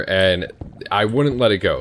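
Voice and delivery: usual speaking voice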